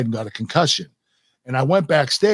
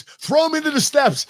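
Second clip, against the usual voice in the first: much higher, urgent mock instruction; whispered ringside cue; 13 semitones higher than usual. Stephanie coaching him in the ring, urgent high cue. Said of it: "and she goes".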